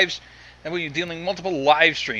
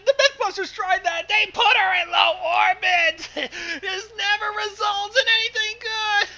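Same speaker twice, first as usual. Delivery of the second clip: much higher, loud, frantic crying out; mock panicked rant; 4 dB louder than usual. Friend warning everyone about hyper Pinkie, in a panicked wail. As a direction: rant like the world is ending.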